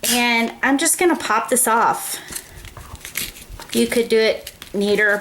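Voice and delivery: ordinary talk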